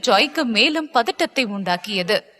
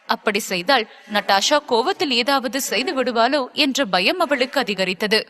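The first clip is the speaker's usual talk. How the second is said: usual speaking voice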